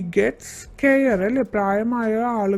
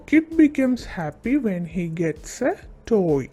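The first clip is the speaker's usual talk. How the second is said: slow dictation pace; much slower than usual. Shown as a demonstration. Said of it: "നമുക്കിതൊന്ന് എഴുതി നോക്കാം".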